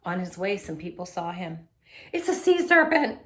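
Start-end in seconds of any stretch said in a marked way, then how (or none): 2.1-3.2 high, loud scared cry; frightened scream. A narrator's reading. Beachgoer spotting the seaweed dog, screaming in fright. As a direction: scream it in alarm. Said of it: "one of them screamed".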